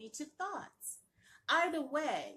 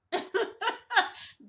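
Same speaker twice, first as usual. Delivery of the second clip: high, loud burst of laughter; laughing; 12 semitones higher, 7 dB louder than usual. Real, not acted. Amused between repeats of web address.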